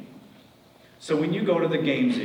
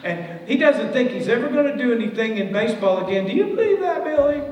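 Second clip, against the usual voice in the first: high, loud, pleading wife voice; rising higher and louder toward "Billy?"; worried wife; 9 semitones higher, 5 dB louder than usual. Retold or imitated. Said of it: "He goes".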